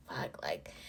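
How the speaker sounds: whispered swear under the breath; hushed curse. Retold or imitated. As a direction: let it out as a soft, toneless breath. Retold shock at shattered glass.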